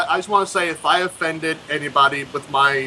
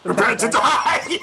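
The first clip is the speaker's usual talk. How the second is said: laughing, mildly dramatic movie line; a hint of Inigo Montoya; 6 semitones higher than usual. Guest finishing the movie quote, lightly acted. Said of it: "Inigo Montoya".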